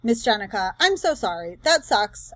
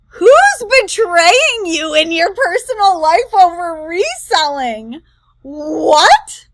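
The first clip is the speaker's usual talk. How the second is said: louder, slowed, incredulous demand; crash out; 5 semitones higher, 8 dB louder, slower than usual. Real, not acted. Reacting to a reseller's betrayal claim, loud incredulous crash out. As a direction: let the disbelief spill over, loud and drawn out. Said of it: "this is the crash out".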